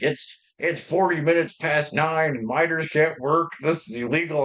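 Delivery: mocking dad's outrage over the late shift; mock dad flipping out. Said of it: "he was all flipping out".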